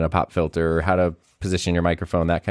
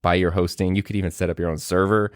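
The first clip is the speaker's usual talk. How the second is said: normal talking voice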